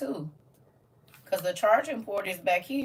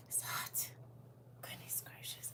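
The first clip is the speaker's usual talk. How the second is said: whisper; 21 dB quieter than usual. Real, not acted. Complaining about the heat.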